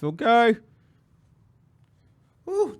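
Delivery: ordinary talk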